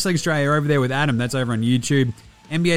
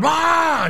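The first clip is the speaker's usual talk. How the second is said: high, drawn-out mock yell; imitated yell; 4 dB louder than usual. Dad imitating his kid while fishing, mock yell. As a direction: mimic a kid hollering at the fish. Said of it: "Just, like, yelling at fish".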